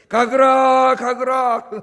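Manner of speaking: loud Gyeongsang preaching shout; Gyeongsang preacher. Retold or imitated. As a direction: bellow 가거라 like the old Gyeongsang pastor in the pulpit. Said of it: "그 목사님이". (경상도 목사님처럼 크게 외치는 "가거라")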